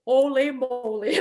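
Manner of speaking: slow, drawn-out holy moly. Retold or imitated. Recalling stage fright, a slow awed holy moly. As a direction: savor the shock slowly. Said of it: "And I'm like".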